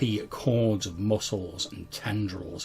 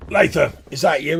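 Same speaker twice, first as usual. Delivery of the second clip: raised, high searching call; 7 semitones higher, 8 dB louder than usual. Acted. Second voice near the waterfall calls to Latham, raised call. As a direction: call out loudly to find someone. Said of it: "you hear a second voice near the waterfall".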